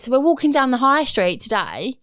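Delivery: ordinary talk, nothing marked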